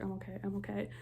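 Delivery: said to himself. Retold or imitated. Percy repeating it to himself, softly. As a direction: murmur "I'm okay" to reassure yourself. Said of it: "saying it to himself".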